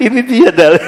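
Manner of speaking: high, loud laugh through the words; laughing triumph. Real, not acted. Preacher laughing at the scholar's clever proof, triumphant. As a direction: announce the proof while laughing.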